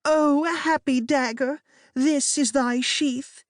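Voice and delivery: high, slow tragic recital; tragic Juliet. A narrator's reading. Juliet speaking her last words, high and slow. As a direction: swoon through the line as a doomed heroine. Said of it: "she takes his dagger and says".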